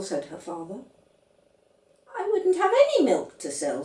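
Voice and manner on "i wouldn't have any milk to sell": put-on high, bright child voice, firm; a small stubborn girl correcting her dad; voiced little girl. A narrator's reading. Little girl contradicting her father, high and firm.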